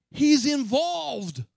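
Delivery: high, very slow, ringing stress on involved; emphatic. Real, not acted. Preacher driving home that God is involved, slow and strong. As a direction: lean hard on every syllable. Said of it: "It's very clear that he has".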